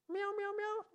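high, small, quick meows; cat meowing. Acted. Cat asks God his question, high soft meowing. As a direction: plead in tiny high cat mews. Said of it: "he looks up at him and he says".

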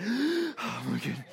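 soft, slow gasping mock panic; hyperventilating mom. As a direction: imitate a mother struggling for breath.

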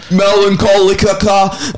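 mock mangled, slow Melancholica; mispronounced band name. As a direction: say Melancholica wrongly, stretched out and high. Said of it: "pronounced my band name so wrong".

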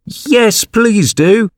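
very high, imploring cry; joining in chorus. A narrator's reading. Animals beg Mouse to return, chorusing high. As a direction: chime in high and keen. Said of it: "others all joined in a chorus".

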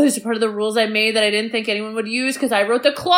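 quick, fed-up rant; salty Bernard. Retold or imitated. Bernard grumbling about his own clause, fast. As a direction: rattle it off like a bitter manager. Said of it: "'cause he's like".

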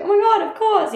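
high, delighted yes; eager friend. Retold or imitated. Friend Franny delightedly agreeing to hear a vent. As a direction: jump at the chance to listen.

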